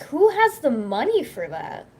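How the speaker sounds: drawn-out, swooping mock disbelief; slow, incredulous comeback in a retold dispute; incredulous retort. Retelling a spat over replacing a mattress, incredulous.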